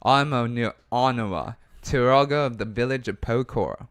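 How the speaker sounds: game villager in put-on Australian; Australian accent. Turaga introducing the village and thanking an adventurer, Australian accent. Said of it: "hear more of your accent".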